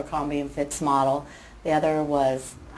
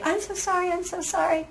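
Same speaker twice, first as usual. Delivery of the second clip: high squeaky imitation of a flustered young man saying sorry, much higher; mock profuse apology; 13 semitones higher than usual. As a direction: play the embarrassed guy apologizing over and over. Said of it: "apologizing profusely".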